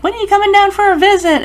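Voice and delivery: high, quick, cheery mock invite; quoted invitation. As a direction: voice the wife's bright invitation. Friend's wife voiced, inviting.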